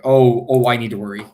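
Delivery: mock panic, loud burst on the ohs; hammed-up inner alarm; mock freak-out. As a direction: act out a mind freaking out, loud then easing. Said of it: "my mind just freaking out".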